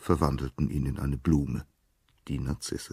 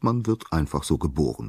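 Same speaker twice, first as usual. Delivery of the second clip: ordinary delivery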